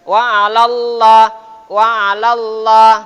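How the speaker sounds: alallah with thick heavy lam, loud; tafkhim lam. Shown as a demonstration. Teacher demonstrating thick lam in Allah. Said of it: "Dibaca tafkhim, akhirnya tebal".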